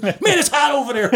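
high, laughing mock voice of a scared firefighter; startled firefighter. Firefighter who ran past comes back shocked, high and mock. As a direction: blurt it high like you just got singed. Runner retreats from fire.

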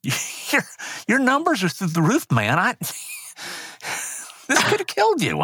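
high-pitched imitated warning broken by laughs; laughing retelling. Nurse warns a patient about his numbers, laughing retelling. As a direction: act out the nurse, laughing through it.

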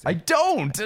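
high-pitched protest; exasperated denial. Real, not acted. Accused co-host denying he loves golf, high exasperated protest. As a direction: yelp a quick denial.

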